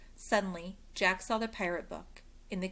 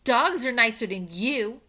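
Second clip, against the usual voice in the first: sassy retort; 4 semitones higher, 10 dB louder than usual. A narrator's reading. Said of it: "said Annie".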